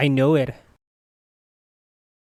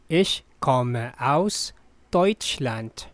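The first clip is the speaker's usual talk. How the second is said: Ich komme aus Deutschland said slowly, stress on Ich; slow and clear; slower than usual. Shown as a demonstration. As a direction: spell it out slowly for the learner. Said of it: "slow repeat for learners". (slow teacher read)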